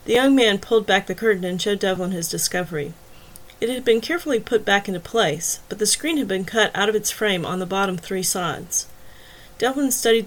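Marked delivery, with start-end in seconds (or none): none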